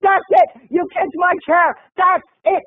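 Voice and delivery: high, emphatic snapping complaint; mock outraged. Fur-coat woman whose chair got kicked, mock outrage. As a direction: imitate a stranger who has had enough. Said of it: "And she goes".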